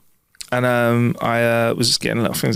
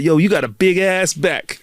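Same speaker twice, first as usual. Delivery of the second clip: catcalling stranger; 6 semitones higher, slower than usual. Retold or imitated. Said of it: "if he was like".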